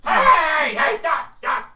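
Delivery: high, loud shouts that fade; kobold shouting stop. Acted. Kobold yells at the party to stop the violence. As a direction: yell to break it up.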